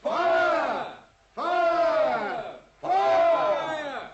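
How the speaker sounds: loud repeated alarm cries; shouting fire again. Acted. Watson repeats the fire cry on Holmes's cue, loud. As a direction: keep yelling fire down the hall. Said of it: "once again, if you please".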